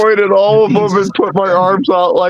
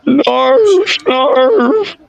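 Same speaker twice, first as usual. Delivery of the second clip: raised, odd creature voice grunting narf; 6 semitones higher than usual. Acted. Player voicing his creature character with a raised grunt. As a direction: bark it out like a simple beast.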